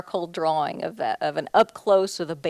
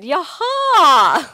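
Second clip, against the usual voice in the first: excited yaha; 10 semitones higher, 12 dB louder than usual. Retold or imitated. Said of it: "she just said".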